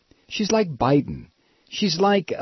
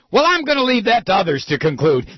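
raised, mocking sound-alike; mocking politician's evasion; 6 semitones higher, 4 dB louder than usual. Host shows how Senator Clinton will sound, high mocking quote. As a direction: parrot the dodge in a high voice. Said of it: "But it's gonna sound like".

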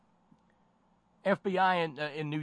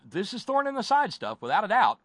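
much higher and louder, all in on it; emphatic outburst; 11 semitones higher, 9 dB louder than usual. Real, not acted. Radio host to listeners, exclaiming loudly. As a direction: burst out with conviction.

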